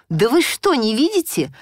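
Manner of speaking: отчаянный крик, выше и громче; кричит, требуя понять. A narrator's reading. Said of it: "закричала я". (громкий отчаянный крик)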